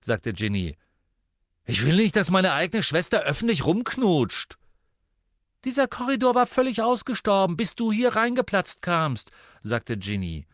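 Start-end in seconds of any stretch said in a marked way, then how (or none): 1.6-4.6 loud, high-pitched indignation; indignant. A narrator's reading. Brother objects to his sister kissing in public, indignant. 5.6-9.3 high, loud, rapid retort; angry retort. A narrator's reading. Sister blames her brother for bursting in, indignant.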